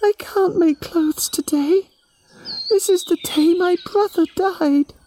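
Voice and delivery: put-on a little girl heartbroken over her brother; Yami grieving. A grieving girl answering the animals, high and sad. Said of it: "Yami shook her head and said".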